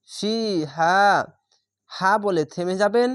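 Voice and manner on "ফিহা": measured recitation of ফিহা with long ফি and হা; one-alif stretch. Shown as a demonstration. Teacher showing where to stop, ফিহা drawn out. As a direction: draw out both vowels of ফিহা, then stop. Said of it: "এক আলিফ টেনে".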